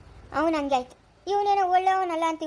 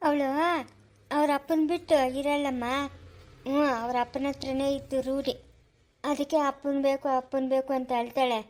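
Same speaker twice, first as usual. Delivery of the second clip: normal talking voice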